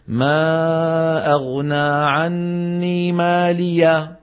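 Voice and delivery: مالية ending in a long ا instead of هاء, chanted slowly; wrong alif ending. Shown as a demonstration. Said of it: "ما بصير". (خطأ إبدال الهاء ألفاً)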